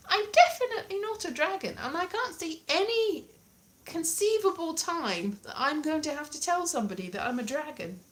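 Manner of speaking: high-pitched, emphatic protest, easing down; mock indignant protest. Learner refusing to be called a dragon, mock indignant. Acted protest at useless phrase.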